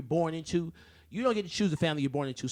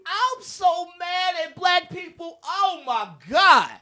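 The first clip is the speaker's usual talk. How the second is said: mock outrage; 12 semitones higher, 4 dB louder, slower than usual. Mocking people's reaction.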